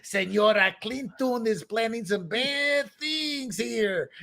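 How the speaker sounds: slow, hammed-up Dutch accent veering into Spanish; mock Dutch accent. Dutch intelligence warning about Clinton, in a mock Dutch accent. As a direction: draw it out in a fake, Spanish-sounding Dutch accent. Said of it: "that sounds more Spanish".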